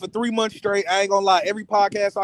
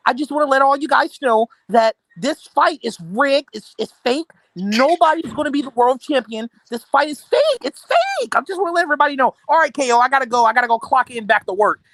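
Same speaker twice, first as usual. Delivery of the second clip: raised-pitch mock video rant, peaking on the accusation; mocking Big Dog Chili; 4 semitones higher than usual. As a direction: imitate his alarmed announcement, voice climbing. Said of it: "you said".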